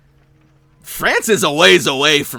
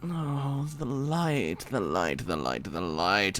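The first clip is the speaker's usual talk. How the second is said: mocking hush; 5 semitones lower, 10 dB quieter than usual. Mocking sing-song repetition.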